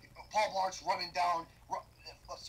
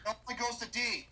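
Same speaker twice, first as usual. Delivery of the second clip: usual speaking voice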